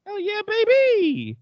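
high, loud, slow hype shout; drawn-out pumped-up yell; excited. Pretend. Hyped about booster shot.

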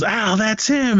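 very high mock cry of recognition; retold alarm. Agent setting off the chase, mock excited cry. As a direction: imitate raising the alarm, drawn-out opening.

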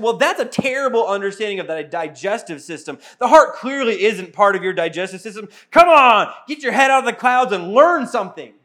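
loud, scornful mock lecture; sneering put-down, building to a shout; mock harsh scolding. Mock harsh answer to daughter.

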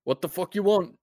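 high, loud, rough shout down from the wall; angry shout. Acted. Guard shouting down at the player from the wall, angry shout. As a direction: bark it down at the stranger. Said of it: "shout back down".

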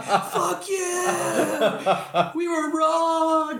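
very high, laughing, shouted whoop; mock triumphant cheer. Pretend fans gloating after Power Rangers wins, laughing shout. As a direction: cheer like a crazed fan while cracking up.

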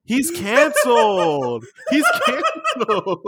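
high, drawn-out falsetto cry; stretched-out squeal of fake horror; mock outrage. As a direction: wail it like a scandalised online mob. Mimics outraged viewers cancelling him.

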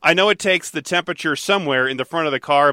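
ordinary delivery

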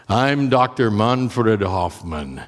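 put-on slow, deliberate German-accented professor's introduction. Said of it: "my German accent's probably terrible".

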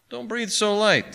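high, slow fretful complaint; peevish high-pitched advice. A narrator's reading. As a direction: fuss at the player, drawing it out. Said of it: "said the pawnbroker fretfully".

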